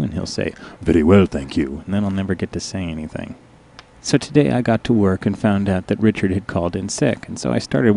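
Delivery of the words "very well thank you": low, smooth and a bit louder; deep suave reply with a faint accent; Richard's low voice. Retold or imitated. Coworker answering the girl in a low suave voice. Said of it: "his voice is really low".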